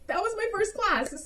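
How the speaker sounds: high, bright excited; excited announcement. Retold or imitated. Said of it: "and I'm like".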